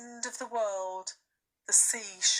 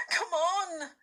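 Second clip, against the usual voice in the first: put-on urgent girl; 10 semitones higher than usual. A narrator's reading. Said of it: "pulling Susan round".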